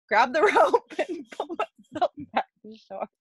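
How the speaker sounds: words breaking up into high laughter; laughing. Real, not acted. Teller laughs at her own rowing mishap, laughing. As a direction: laugh helplessly through the line.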